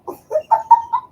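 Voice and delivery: very high squealing laugh; triumphant laugh. Real, not acted. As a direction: squeal with relief at remembering. Laughs after remembering Nashville.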